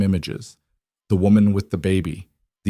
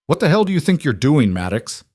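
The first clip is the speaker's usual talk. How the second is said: furious demand; 6 semitones higher than usual. A narrator's reading. Webb furious at Cole.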